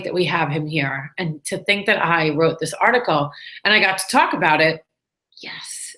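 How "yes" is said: breathy secret yes of triumph; whispered yes. Real, not acted. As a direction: whisper it to yourself. Private triumphant little whisper.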